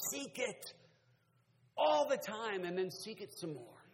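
high, loud preacher's urging; impassioned pulpit call; forceful exhortation. Real, not acted. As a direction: drive the command into the congregation. Urges congregation at full force.

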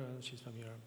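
ordinary delivery

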